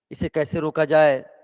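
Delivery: ordinary talk, nothing marked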